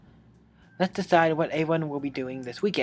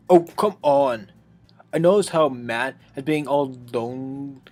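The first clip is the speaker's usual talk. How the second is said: exasperated Natsuki; 4 dB louder, slower than usual. Acted. Natsuki complains loudly to the club.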